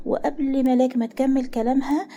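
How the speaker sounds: plain reading voice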